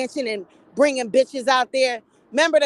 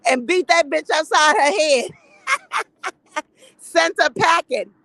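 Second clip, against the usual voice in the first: laughing; 4 semitones higher, 4 dB louder than usual. Real, not acted. Laughing through punchline.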